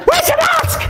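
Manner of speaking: very high, screechy demand; imitating a frantic accuser; shouting old man. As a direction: yell it like an outraged old man. Acted old man yelling again.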